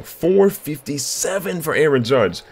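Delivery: high, slow, drawn-out amazement; stretched-out, incredulous announcement; astonished. Real, not acted. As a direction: read the number out in sheer disbelief. Astonished at home run distance.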